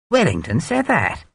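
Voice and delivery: mild astonished question. A narrator's reading. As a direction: catch the surprise. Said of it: "Runciman asked in astonishment".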